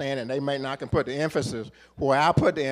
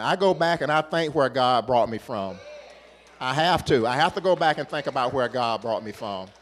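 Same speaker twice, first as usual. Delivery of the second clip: louder preaching cadence, building to the congregation; full-force preaching; 2 semitones higher, 6 dB louder than usual. Acted. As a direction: preach it with rising fire, hammering each line.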